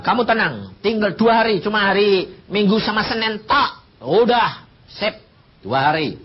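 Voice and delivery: emphatic pastor telling him to rest; firm loud reassurance. Retold or imitated. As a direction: press the reassurance home. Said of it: "Saya bilang".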